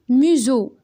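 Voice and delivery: "Museau" said loud with a clear Z sound for the S; teacher models "Museau" with a Z; pronunciation demo. Shown as a demonstration. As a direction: stress "Museau" as myzo, S voiced like Z. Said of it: "Le S entre deux voyelles se prononce Z".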